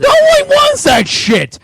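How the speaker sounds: loud, slow, high yell; outraged yell. Real, not acted. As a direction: blow up at the whole chat. Ranting at chat about a game.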